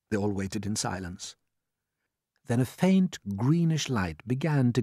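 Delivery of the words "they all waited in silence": ordinary delivery, nothing marked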